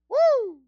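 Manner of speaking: high loud woo; excited whoop. Real, not acted. Preacher whooping to the congregation.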